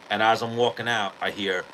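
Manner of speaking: ordinary talk, nothing marked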